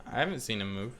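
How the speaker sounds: normal talking voice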